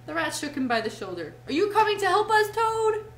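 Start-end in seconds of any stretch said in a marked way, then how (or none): 1.5-3.1 loud, high-pitched demand; stern demand. A narrator's reading. Rat shakes Toad by the shoulder, stern loud demand. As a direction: demand an answer, raised voice. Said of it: "he demanded sternly".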